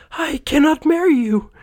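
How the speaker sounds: put-on very high, sad girl's voice; lovely girl refusing to marry young Scrooge; regretful young woman. A narrator's reading. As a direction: break it to him sadly. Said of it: "she said regretfully".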